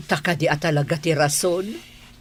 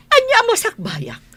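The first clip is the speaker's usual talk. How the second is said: outraged disbelief. Acted. Wife repeating husband's word in disbelief.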